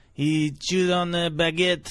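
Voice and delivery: a light put-on French accent, slowed; hammed-up French accent telling his tale; thick French accent. Jean-Paul the French guy telling his story, light French accent. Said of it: "He spoke like this".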